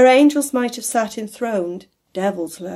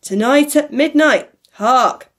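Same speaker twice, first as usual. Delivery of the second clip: loud, raised ghostly announcement; urgent proclamation; 4 semitones higher, 6 dB louder than usual. A narrator's reading. The ghost warns Scrooge time is short, loud proclamation. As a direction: call it out as a warning.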